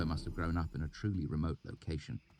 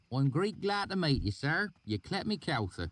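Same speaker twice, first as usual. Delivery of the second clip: high rustic voice; put-on valley dialect; 11 semitones higher than usual. A narrator's reading. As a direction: fake the local dialect. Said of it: "sudden change in dialect".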